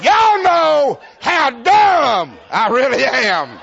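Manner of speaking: high, loud, slow, drawn-out comic shout; preacher shouting his own foolishness. Real, not acted. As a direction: bellow it at the crowd like a punchline. Preacher shouting at laughing crowd.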